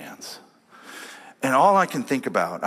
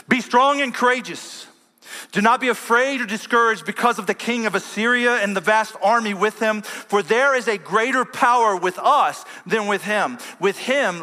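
preaching at full force; 11 semitones higher, 4 dB louder than usual. Acted. Pastor booming Hezekiah's words to his army, preaching at full force. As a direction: thunder it like a preacher rallying troops.

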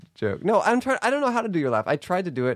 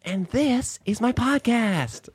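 high, very slow drawn-out tagline; Alexi Wasser impression; 8 semitones higher, much slower than usual. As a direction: stretch the show tagline like a mimicked host. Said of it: "There's, there's something about it like that".

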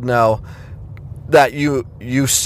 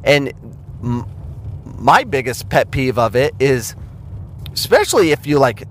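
ordinary delivery

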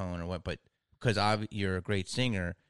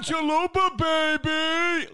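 much higher, shouted sportscaster hype; Dick Vitale; 8 dB louder, much slower than usual. Retold or imitated. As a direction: yell it like a hyped college basketball announcer. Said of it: "You know Dick Vitale?".

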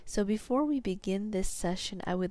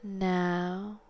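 sleep story narrator; slower than usual. Acted. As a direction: guide them down into sleep, slowly. Guided hypnosis induction.

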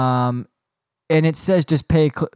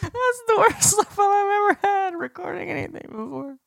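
very high, squeaky helpless laughter; barely getting the words out through tears of laughter; crying with laughter. Real, not acted. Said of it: "I'm crying".

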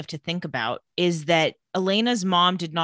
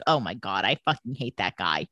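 low, muttered disgust, far below usual pitch; retold loathing; 11 semitones lower than usual. Elena reacts to Damon cheersing his glass, with low loathing. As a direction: grumble it as a mock aside of hatred.

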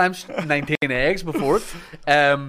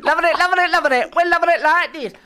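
very high, louder mock jingle, laughing; joke advert slogan; 4 dB louder than usual. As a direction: squeal the slogan over and over for laughs. Joke McDonald's audition line.

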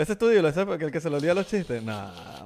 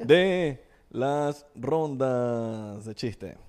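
slow announcer; much slower than usual. Acted. End of announcement.

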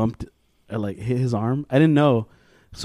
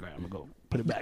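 low, soft sneaky mumble; sneaky aside; 9 semitones lower, 13 dB quieter, much faster than usual. Retold or imitated. Grader sneaking it back once she left, hushed. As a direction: say it low and soft, nearly laughing.

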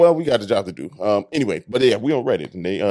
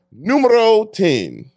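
grand announcement; 10 semitones higher, 5 dB louder, much slower than usual. Acted. Mock-grand number announcement.

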